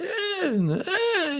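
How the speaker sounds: imitating an angry man's voice cracking up high. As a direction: squeak the eh way up as the voice breaks. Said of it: "he starts cracking".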